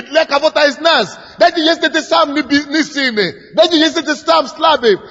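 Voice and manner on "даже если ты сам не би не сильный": ordinary talk, nothing marked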